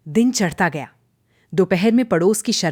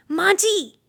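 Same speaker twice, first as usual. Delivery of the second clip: high startled call; alarmed cry; 13 semitones higher, slower than usual. A narrator's reading. Daughter-in-law finding fallen mother-in-law, crying out in alarm. Bahu finds injured saas.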